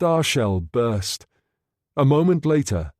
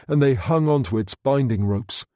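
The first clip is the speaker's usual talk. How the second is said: normal talking voice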